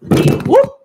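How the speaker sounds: loud high breathy whoop. Real, not acted. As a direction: cheer like at a party. Cheering exclamation.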